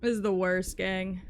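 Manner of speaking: ordinary talk, nothing marked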